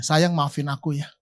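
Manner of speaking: soft, low words of apology to his wife; soft and quick, like pleading for forgiveness; husband softly asking his wife's forgiveness; tender apology. Retold or imitated. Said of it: "Saya tatap mata dia, saya ngomong gini".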